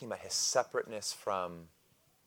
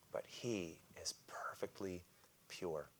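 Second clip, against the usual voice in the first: whispered, much softer and slow; hushed whisper; 3 semitones lower, 14 dB quieter, slower than usual. Real, not acted. Preacher closing on God's purity, whispered. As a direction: breathe it out in awe.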